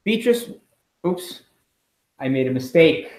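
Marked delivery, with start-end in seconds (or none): none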